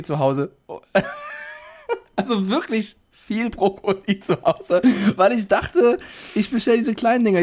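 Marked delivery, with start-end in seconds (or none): 0.7-7.4 high, slow, laughing through the words; can't stop laughing at own blunder; confessing the broccoli mix-up while laughing helplessly. Real, not acted.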